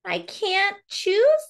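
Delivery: high and slow, moaning the word choose; whiny Charlie.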